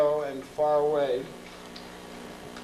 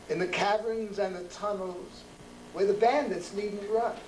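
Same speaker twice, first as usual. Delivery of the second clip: measured, rhythmic poem recital with pauses; poetry reading; 3 semitones higher than usual. Acted. Poet reciting Saint Mark's Place poem to a live audience.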